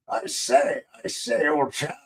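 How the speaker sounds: high-pitched mock British gent. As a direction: do a goofy, exaggerated British old-chap voice. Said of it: "I have to revert to the stereotypical".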